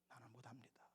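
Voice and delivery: whispered, barely breathing the refusal; hushed plea. Acted. Moses whispering his refusal to God. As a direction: shrink back and whisper your excuse.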